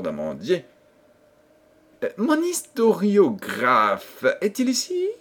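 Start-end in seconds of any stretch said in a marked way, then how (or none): 2.0-5.1 high, slow kingly query; pompous storybook king. A narrator's reading. King asking after his historiographer, pompous. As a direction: call for your servant like a fussy king.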